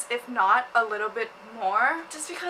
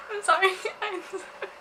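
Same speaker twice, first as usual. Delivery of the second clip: giggling through an apology; real helpless laughter, higher; laughing apology; 7 semitones higher than usual. Real, not acted. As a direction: say sorry while cracking up. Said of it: "I'm laughing".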